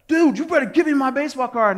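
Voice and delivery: high-pitched shouted threat; furious demand. Retold or imitated. Boy warns the friend who laughed at him, furious demand. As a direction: yell it high, like a kid losing it.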